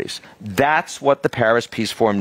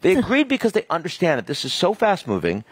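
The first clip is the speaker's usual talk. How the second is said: passionate; 8 semitones higher than usual. Real, not acted. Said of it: "you're so passionate".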